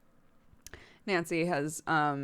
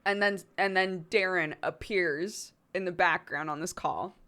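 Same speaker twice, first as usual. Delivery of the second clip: usual speaking voice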